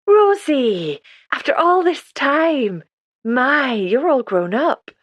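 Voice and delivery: light up as you recognise her; delighted recognition. A narrator's reading.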